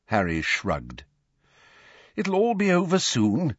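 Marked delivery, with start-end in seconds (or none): 2.1-3.5 lifted, higher-pitched reassurance; bracing encouragement, pitched up. A narrator's reading. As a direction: buck someone up. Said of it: "Mister Weasley said bracingly".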